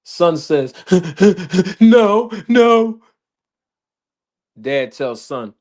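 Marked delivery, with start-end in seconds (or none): 0.8-1.8 a child's sob imitated. Son sobbing before answering. 1.8-3.0 high, louder sobbing "no"; mock tearful child's denial; crying child. Crying son answers dad.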